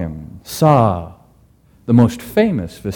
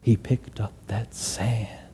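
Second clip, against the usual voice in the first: whispered narration; 4 semitones lower, 12 dB quieter than usual. A narrator's reading. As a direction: draw listeners close, softly.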